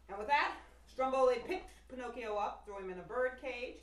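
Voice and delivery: usual speaking voice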